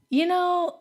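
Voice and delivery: high, lightly voiced voice in the head; tempting inner voice. Voice in her head talking to a runner, lightly coaxing. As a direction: float it in high, like a passing thought. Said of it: "there's a voice in my head".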